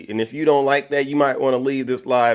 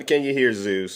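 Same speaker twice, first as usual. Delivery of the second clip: ordinary delivery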